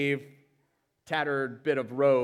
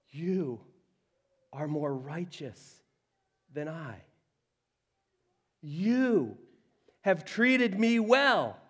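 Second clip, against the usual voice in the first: weeping Saul; 5 semitones higher, 5 dB louder than usual. Acted. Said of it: "He wept aloud".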